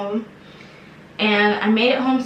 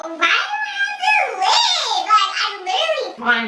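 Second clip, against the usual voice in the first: put-on drunk whine; 11 semitones higher than usual. To the bouncer, a high crying drunk whine. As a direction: whine like a drunk girl begging to stay.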